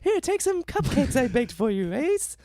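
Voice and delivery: high, sweet doting mother voice; mock mom voice. Comic skit, mom offering bully cupcakes, high sweet mom voice. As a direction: play the syrupy doting mum.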